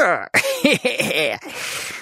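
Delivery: high, mocking giggle; high schoolboy snicker; jeering laugh. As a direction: cackle at your own joke. Schoolboy laughs at own joke.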